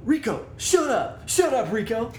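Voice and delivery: high, loud imitation of a dog owner; mock scolding yell. Man to his barking dog Rico, mock scolding yell.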